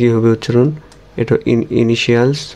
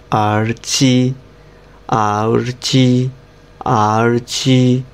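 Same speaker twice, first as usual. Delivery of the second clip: আরচি dragged out very slowly; very slow drill; much slower than usual. Shown as a demonstration. Teacher slowly drilling আরচি for students. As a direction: pronounce আরচি extremely slowly and clearly. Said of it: "slow modeling drill".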